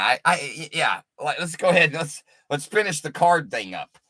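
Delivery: usual speaking voice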